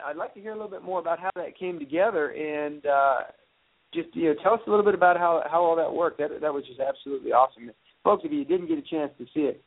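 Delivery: tinny iPhone line sound; talking through a poor phone connection; phone audio. Real, not acted. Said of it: "We're having a little technical difficulties".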